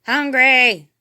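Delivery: loud monster bellow; loud hungry roar; menace bellowing. A narrator's reading. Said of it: "bellowed the masked menace".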